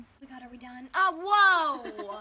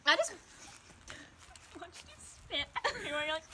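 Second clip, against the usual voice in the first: laughing; 3 semitones higher, 6 dB quieter than usual. Real, not acted. Laughing at friend.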